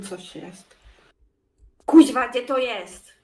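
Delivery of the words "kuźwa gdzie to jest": głośny, przeciągnięty wybuch irytacji; rzuć to głośniej i wyżej, z frustracją; zirytowana. Real, not acted. Zirytowana, szuka drogi w grze. (poirytowany okrzyk)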